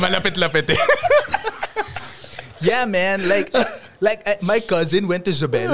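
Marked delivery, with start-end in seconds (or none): 2.6-5.7 put-on light Alabang rich-kid English; a touch of laid-back Alabang kid; Alabang accent. Shown as a demonstration. Demoing his Alabang accent for friend, lightly. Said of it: "yung Makati ko tsaka yung Alabang ko".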